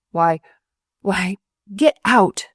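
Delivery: slow, loud gasped words; gasping. A narrator's reading. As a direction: gasp it out in shock. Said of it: "gasped the senior surgeon".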